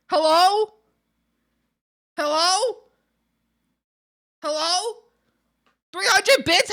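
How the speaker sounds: loud, high questioning hello; repeated loud rising hellos; calling hello. Pretend. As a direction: call out over and over. Repeated greeting calls.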